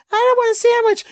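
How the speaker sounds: squeaky put-on wife refusing; mock wife voice. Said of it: "she comes in and like".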